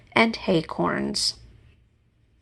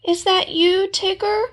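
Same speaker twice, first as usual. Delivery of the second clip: calling out; 12 semitones higher, 4 dB louder than usual. Acted. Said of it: "called Pooh".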